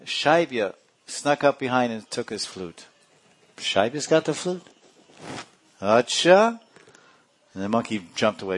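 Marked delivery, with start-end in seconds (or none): 3.6-6.6 high, rising astonished questions; acted surprise. Hearing who stole the flute, acted surprise, high and rising. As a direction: react with mock astonishment, pitched up.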